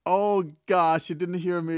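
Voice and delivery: exasperated surprise. Real, not acted. Phone sent message wrongly.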